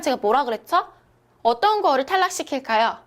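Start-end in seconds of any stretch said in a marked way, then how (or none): none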